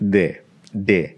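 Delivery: "De" with the D made at the teeth; "De" with the tongue touching the teeth; dental D. Shown as a demonstration. Teacher shows the Spanish dental D. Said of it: "your D is more like this".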